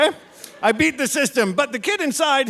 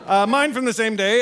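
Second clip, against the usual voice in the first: usual speaking voice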